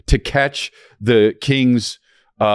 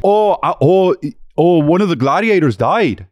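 hammed-up Minnesota accent, pitched up; Fargo accent; 6 semitones higher than usual. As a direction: report it like a Fargo local. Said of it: "from Fargo".

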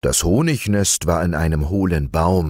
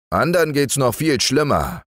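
raised, fussy bear voice; Hieronymus the bear; 9 semitones higher than usual. Acted. Hieronymus stuck at the knothole, fussing to Basilius. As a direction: complain the hole is too narrow.

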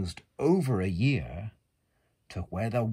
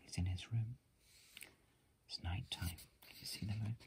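whisper; 14 dB quieter, faster than usual. Real, not acted. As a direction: whisper it gently over the book.

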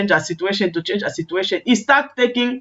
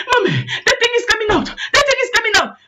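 put-on high, frantic child's cry; child screaming; 11 semitones higher, faster than usual. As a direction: scream to mommy in panic, acting it out. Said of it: "starting screaming".

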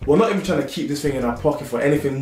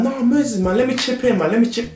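pleading friend; 10 semitones higher than usual. Retold or imitated. Friend begging to chip in.

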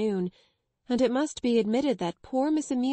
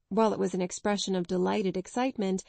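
usual speaking voice

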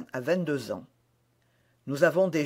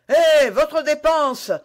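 loud, high, drawn-out yell; slow, long-carrying angry shout; vociferating; 10 semitones higher, 9 dB louder, slower than usual. A narrator's reading. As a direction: bellow after someone riding off. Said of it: "vociféra l'hôte".

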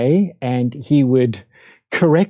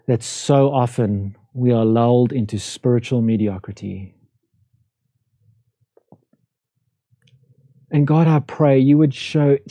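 closing prayer. Real, not acted. Pastor leads closing prayer.

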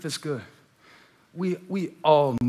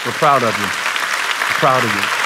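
slow sincere praise; 3 semitones lower, 5 dB louder, slower than usual. Real, not acted. Repeated tender affirmation.

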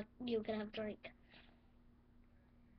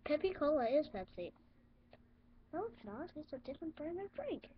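ordinary talk, nothing marked

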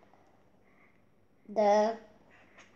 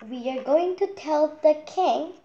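a bit higher and louder hen; lightly voiced hen; 7 semitones higher, 5 dB louder than usual. Henny Penny telling Cookie Lookie the plan, lightly voiced. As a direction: announce the plan. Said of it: "said Henny Penny".

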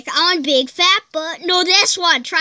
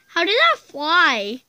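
normal talking voice

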